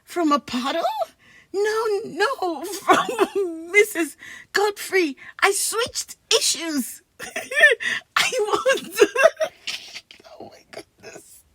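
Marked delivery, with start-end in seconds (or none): 1.5-7.2 slow, giggly correction; words wobbling with laughter; laughing while talking. Real, not acted. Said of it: "I can't stop laughing". 8.2-10.0 giggling, high and loud; can't finish the line for laughing; helpless giggle fit; high fits of laughter. Real, not acted. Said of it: "I can't stop laughing". 10.4-11.4 breathy whisper while cracking up; laughing whisper; whispered through laughter. Real, not acted. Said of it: "I can't stop laughing".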